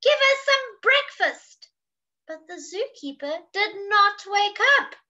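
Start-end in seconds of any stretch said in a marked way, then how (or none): 0.0-1.7 high, loud demand from hungry animals; loud storybook clamour; crying out. Acted. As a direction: call out hungrily at a raised pitch. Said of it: "cried the hungry animals".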